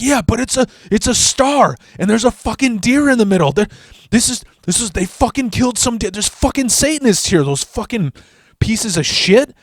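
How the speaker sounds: high panicked rant; freaked-out friend. Retold or imitated. Friend explaining the killed deer in a high, panicked voice. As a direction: squeak it out like he's scared stiff.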